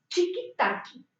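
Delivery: Chiquitaqui said with a ch start and an added i at the end; Portuguese pronunciation. Shown as a demonstration. Teacher answering how the word is said, Portuguese style. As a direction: say Chiquitaqui with the extra final i. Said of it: "¿Y cómo se pronuncia?".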